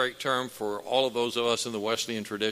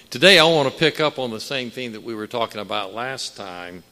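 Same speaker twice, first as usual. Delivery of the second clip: loud, rising preacher's cadence; preaching at full force; 4 semitones higher, 9 dB louder than usual. Acted. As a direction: preach it out with fire to the congregation.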